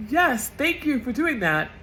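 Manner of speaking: high, slow mocking voice of a Trump supporter; mock grateful supporter. Vlogger mocks grateful Trump voters, high and slow. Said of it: "those of you that are like".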